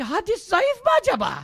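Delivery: squeaky parody of a smug doubter; mocking the ignorant. Preacher parodying an ignorant man questioning hadith, high put-on voice. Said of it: "Diyor ki".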